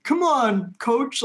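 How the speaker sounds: high whiny plea; whiny kid. Retold or imitated. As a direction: whine it like a sulky kid. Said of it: "sounds like a whiny kid being like".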